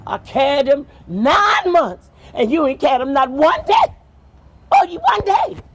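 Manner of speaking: wife furiously reminding the king she carried them; angry wife. Acted. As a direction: lay into the king hard.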